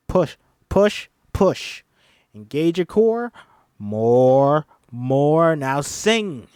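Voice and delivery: loud pushing coach. Acted. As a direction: push her harder. Coaching Ruby.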